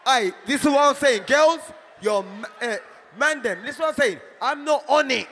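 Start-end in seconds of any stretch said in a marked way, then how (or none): 0.0-1.6 loud hype. Real, not acted. Hyping up the crowd. 4.4-5.3 emphatic shout. Real, not acted. Emphatic denial to crowd.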